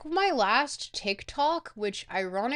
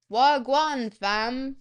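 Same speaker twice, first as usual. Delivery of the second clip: Toronto slang; 5 dB louder, much slower than usual. Shown as a demonstration. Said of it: "speak like a true Torontonian".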